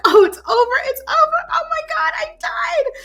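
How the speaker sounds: high, cried-out wail of doom; hammed-up terror as if going under; mock panic. Retelling sinking in the water, high panicked crying shout.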